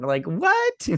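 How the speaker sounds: high-pitched disbelieving outburst; incredulous. Real, not acted. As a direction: leap up in disbelief, near laughing. Incredulous reaction to retold line.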